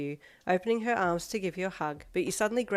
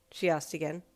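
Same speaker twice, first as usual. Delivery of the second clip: ordinary delivery, nothing marked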